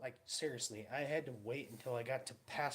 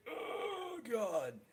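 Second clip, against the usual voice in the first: gasping oh God; 10 semitones higher, 3 dB louder than usual. Retold or imitated. Reacting to icy throat.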